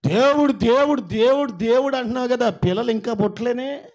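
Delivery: put-on loud, high jeering at a childless believer; mocking taunt. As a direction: sneer it out loud like a scornful relative. Preacher voices mocking relatives' taunt.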